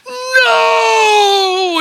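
put-on high, loud child's cry of refusal; terrified child shout. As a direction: scream no like a frightened boy. Boy refusing to let go.

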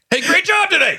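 loud, high shouted praise; mock husband barking praise at his wife; aggressive compliment. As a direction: yell the compliment like a threat.

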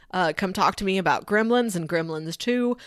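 ordinary delivery

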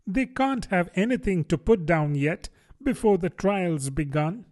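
put-on a girl puzzling aloud; Alice puzzled. Alice to the griffin.

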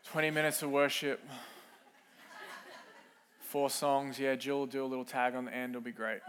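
bored churchgoer. Retold or imitated. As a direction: shrug it off like routine. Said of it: "we just thought".

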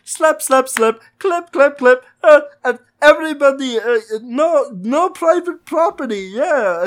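high, hammed-up cartoon boy voice with a heavy put-on Eastern European accent; Stalinist Bolby Stroganowski. Man doing a Stalinist cartoon boy for his cohost, high and hammy. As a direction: squeak it out like a goofy cartoon kid with a thick fake Eastern European accent. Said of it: "Stalinist Bolby Stroganowski".

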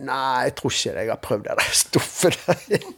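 laughing through a joking answer; laughing retort. Retold or imitated. Laughing as he turns down a friend's meeting invite. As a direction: chuckle while brushing off the invite. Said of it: "Så sa jeg til han".

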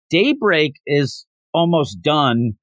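ordinary talk, nothing marked

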